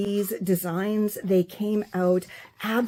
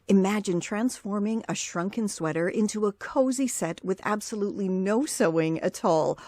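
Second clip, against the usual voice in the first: a quieter, softer promo read of the back cover; soft blurb read; 3 semitones lower, 5 dB quieter than usual. Acted. Creator reading her book's blurb to viewers, soft promo read. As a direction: read the blurb like a gentle book ad. Reads book blurb aloud.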